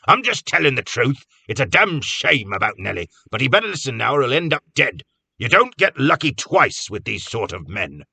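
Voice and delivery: blunt warning. A narrator's reading. Cobb lecturing Carter bluntly.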